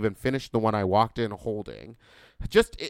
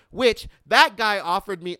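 worked-up, high and loud; yelling; 8 semitones higher, 8 dB louder than usual. Real, not acted. Podcaster getting heated over a car offer, yelling. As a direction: get carried away and yell it. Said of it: "I'm yelling and I don't want to".

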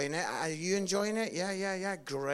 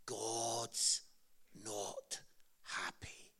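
very slow, much lower and softer, fading to a whisper on "happy"; doom-laden preacher; 10 semitones lower, 9 dB quieter, much slower than usual. Retold or imitated. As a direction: drop the voice word by word like a grim evangelist. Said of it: "He always started by saying".

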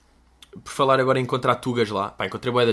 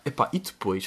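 ordinary delivery

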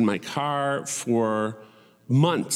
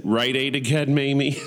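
teasing friend; 5 semitones higher, 3 dB louder than usual. Retold or imitated. Friend's teasing text, acted out.

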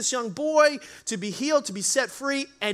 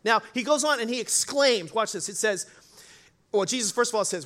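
usual speaking voice

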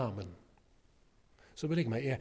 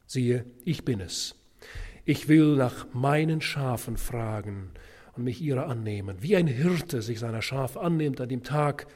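solemn scripture reading; 5 dB louder than usual. Acted. Preacher reading Ezekiel aloud to Bible students. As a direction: proclaim it as the Lord's own voice. Reads Ezekiel as God speaking.

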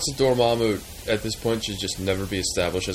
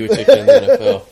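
excited; 13 semitones higher, 11 dB louder than usual. Real, not acted. Boasts about suing the NFL.

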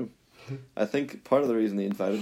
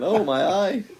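higher, louder teasing mimicry; mock imitation; 10 semitones higher, 6 dB louder, slower than usual. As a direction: mimic a friend's exclamation for laughs. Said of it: "And you said".

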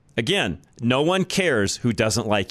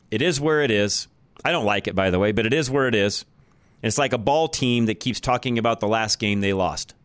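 ordinary talk

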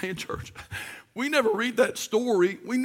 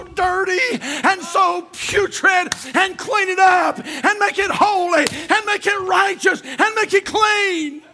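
full-force preaching; 4 semitones higher than usual. Acted. Pastor firing up the congregation in full preach. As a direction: preach it up to a fever.

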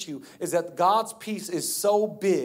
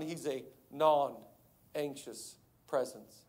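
low, soft, slow and unhurried; non-anxious calm; 3 semitones lower, 8 dB quieter, much slower than usual. Acted. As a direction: settle right down into total calm. Jesus calm against the panic.

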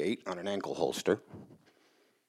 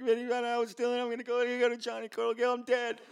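high-pitched panicked babble, acted out; crying, freaking out; 12 semitones higher, faster than usual. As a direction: imitate a terrified kid wailing to friends, very high. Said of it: "crying. I'm freaking out".

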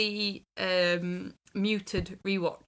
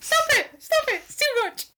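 high, loud gushing plea; overwhelmed squeal; 7 dB louder than usual. Retold or imitated. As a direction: cry out as if it's too much. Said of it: "watching it going".

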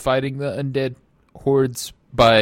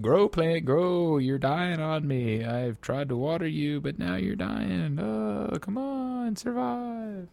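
soft, higher mock-despairing plea; hushed, drawn-out coaxing that rises to a wail; pleading with plant; 4 semitones higher, 7 dB quieter, slower than usual. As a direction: beg a dying plant, voice climbing. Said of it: "You're like".

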